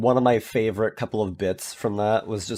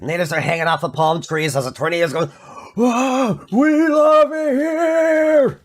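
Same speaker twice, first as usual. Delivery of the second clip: Walter impression; 12 semitones higher, slower than usual. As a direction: quote Walter, then yell the tourists' whoa. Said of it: "Walter's just like".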